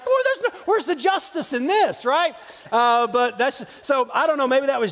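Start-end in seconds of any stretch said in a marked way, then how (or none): 0.0-2.3 high, indignant whine; playful cry of injustice; mock outrage. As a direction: protest the unfairness like a wronged kid. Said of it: "I'm like".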